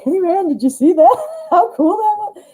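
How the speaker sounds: high-pitched, giggly mock astonishment; excited high voice breaking into laughter; acted amazement. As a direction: play turning to a buddy in amazed delight. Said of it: "look around and be like".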